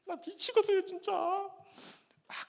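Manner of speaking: high, loud wailing cry to God; loud tearful wail of reproach; crying prayer. Retold or imitated. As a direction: sob it out, pleading and desperate. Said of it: "울면서 기도를 했습니다".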